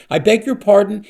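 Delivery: can't believe his ears; disbelief. A narrator's reading. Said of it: "he finally said in disbelief".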